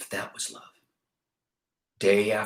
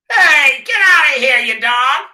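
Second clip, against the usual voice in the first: much higher, very loud yell; furious shout; 9 dB louder than usual. Acted. Ernest yells at the dog to get out of his garbage, shouting.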